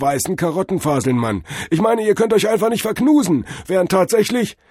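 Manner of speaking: high, loud, carried away; excited revelation. A narrator's reading. Cordell works out the carrot theory, high excitement. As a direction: pour it out as insight floods in.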